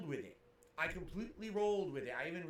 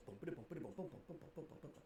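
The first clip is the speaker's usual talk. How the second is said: bump noises; 4 semitones lower, 12 dB quieter than usual. Retold or imitated. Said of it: "bumping each other's butts together like this".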